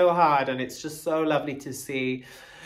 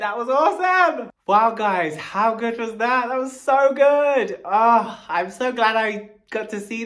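gushing excitement; 7 semitones higher, 4 dB louder than usual. Real, not acted. Gushing after the dance.

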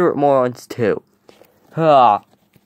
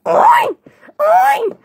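very high squealed "oy"; short piercing silly squeals; goofy screaming; 3 dB louder than usual. Acted. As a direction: screech it like a noisemaker. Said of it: "he's gonna scream and wake up".

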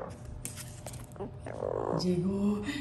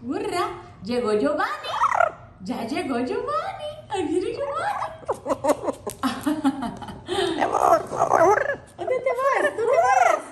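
high, delighted calling out; excited pet greeting; 9 semitones higher than usual. Real, not acted. Owner squealing as Giovanni arrives.

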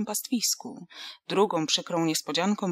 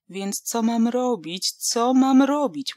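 slow, desperate plea rising louder; despairing; 5 semitones higher, 8 dB louder, slower than usual. A narrator's reading. Łucja begs the doctor's wife for help, slow and desperate. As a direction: wring out the words in despair. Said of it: "Łucja załamała ręce".

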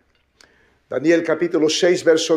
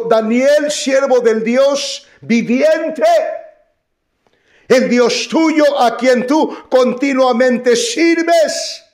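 higher, slow, louder call to Daniel; calling out sadly; 8 semitones higher, 5 dB louder, slower than usual. Acted. King calls to Daniel in the den, slow loud cry. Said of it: "llamó a voces a Daniel con voz triste".

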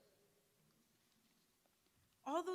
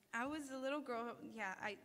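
ordinary delivery

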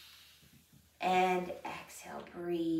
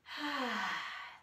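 dramatic sigh; 4 semitones higher, 4 dB quieter than usual. Shown as a demonstration. Yoga teacher breathing out.

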